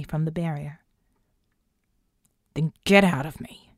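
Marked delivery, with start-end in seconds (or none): 2.5-3.7 snarl. A narrator's reading. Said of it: "he snarled".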